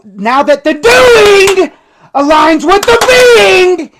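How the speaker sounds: choked up, crying through the words; real tears breaking the sentence; emotional crying. Real, not acted. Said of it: "I'm sorry that I'm getting emotional".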